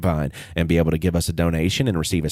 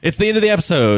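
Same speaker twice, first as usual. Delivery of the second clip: radio voice; 9 semitones higher, 4 dB louder, faster than usual. Acted. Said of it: "that is what he did".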